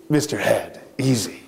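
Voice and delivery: low soft near-whisper; creepy whispered warning; hushed ghostly aside. Acted. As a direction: murmur it like a ghost. Ghost warns her helper.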